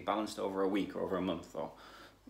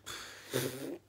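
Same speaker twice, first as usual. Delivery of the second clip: weary sigh; 5 semitones higher, 6 dB quieter than usual. Real, not acted. Frustrated with the failed monitoring.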